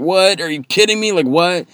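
high, loud outraged yell; screaming at the TV. Retold or imitated. Fan yelling at the TV over the ref's horrible call. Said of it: "I'm, like, screaming".

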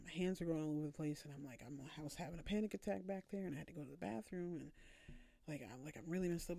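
fast, rushed panicky rambling; talking fast. Shown as a demonstration. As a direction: gush it out in a breathless rush. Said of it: "I'm talking fast".

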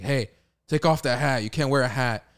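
lightly acted order to a student; mock scolding principal. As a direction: call out like a vice principal catching someone. Vice principal scolding, retold.

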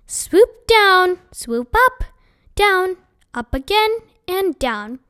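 teacher instructing class. Acted. Teacher demonstrating cursive M.

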